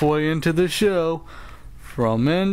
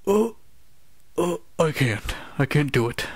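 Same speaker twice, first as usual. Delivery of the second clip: usual speaking voice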